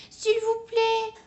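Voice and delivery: high, slow begging "please"; pleading. A narrator's reading. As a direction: plead with the shopkeeper. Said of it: "supplie Plume".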